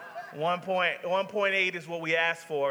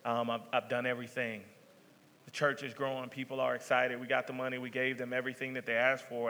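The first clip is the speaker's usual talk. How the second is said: retold despair; 5 semitones lower, 4 dB quieter than usual. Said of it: "I'm pretty much in tears".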